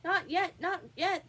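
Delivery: high, loud, hurried rabbit; hasty interruption. A narrator's reading. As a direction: cut in hastily and high. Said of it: "the rabbit hastily interrupted".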